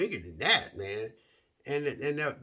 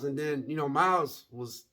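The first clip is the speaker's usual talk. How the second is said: normal talking voice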